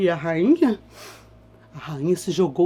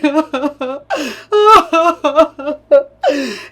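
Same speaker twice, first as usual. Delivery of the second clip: theatrical sobbing wail; 8 semitones higher, 6 dB louder than usual. Acted. After wailing.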